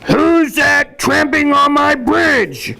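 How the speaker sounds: high, slow, drawn-out bellow; troll roar. A narrator's reading. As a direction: roar the challenge across the bridge. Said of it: "roared the troll".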